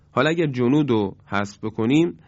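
ordinary talk, nothing marked

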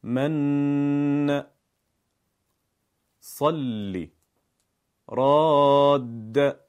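slow, drawn-out recitation of من صلی راد with a held ending; demonstrating doubled letter. Shown as a demonstration. Teacher shows students an example, slow and stretched.

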